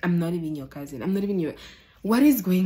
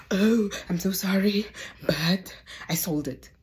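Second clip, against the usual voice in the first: breathy near-whisper apology, slowing at the end; mock-guilty voice owning up; sheepish confession; 4 semitones higher than usual. Boyfriend confessing to the police officer, guilty near-whisper.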